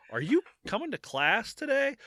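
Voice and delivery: school caller. Pretend. School calls him on the phone.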